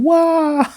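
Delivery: very high, drawn-out mock "wah"; background wail. Imitating Yor in the background, a high mock wail. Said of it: "in the back going".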